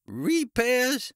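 high, incredulous croak. A narrator's reading. Ice cream man throwing the officer's word back, croaking. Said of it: "the ice cream man croaked".

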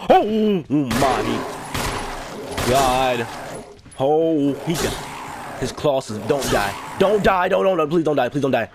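loud, high-pitched panicked pleading; panicked yelling. Real, not acted. Player begging the character not to die, panicked yelling. Panics as zombie attacks in game.

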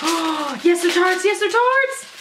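high voice, climbing on the repeat; mounting excitement. Real, not acted. Vlogger discovering wax tarts, rising excitement.

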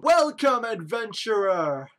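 loud, slow, grandiose greeting; pompous mayor. Acted. Mayor welcoming an adventurer, loud and drawn out. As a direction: draw it out like a self-important official. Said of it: "Dubious Mayor Dudley".

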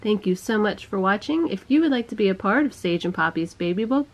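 soft scripted sign-off read; vlog outro. Acted.

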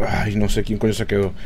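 usual speaking voice

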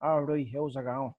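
demonstrated Northside Dublin accent; north Dublin accent. Shown as a demonstration. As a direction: show how Dublin's north side talks. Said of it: "up the north side".

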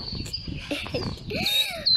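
very high soft giggle; a high breathy embarrassed giggle; squeaky laugh. Real, not acted. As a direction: giggle at your own blunder. Laughing at his own mistake.

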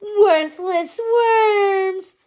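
high, loud, long-stretched wail; grovelling minions. A narrator's reading. Pain and Panic writhe before Hades, grovelling. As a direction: wail in abject self-abasement, very slowly. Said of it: "they groveled, writhing around".

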